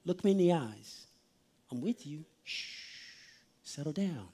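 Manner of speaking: put-on soft, low reassurance to a child; quiet, calming parent voice; gentle parent soothing. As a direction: hush and settle an upset child tenderly. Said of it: "grabbed your child's face very gently".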